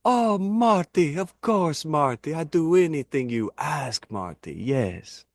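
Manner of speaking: put-on slow, high-pitched Iranian-accented Iron Sheik; eager wrestler in a thick Iranian accent; hammed-up Iron Sheik voice; Iron Sheik impression. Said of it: "I'll try to do the Iron Sheik Marty impression".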